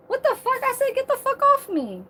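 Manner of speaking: high, mock-outraged quote of herself; retold indignant protest. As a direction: act out pushing someone away, voice jumping up. Retelling telling man to back off.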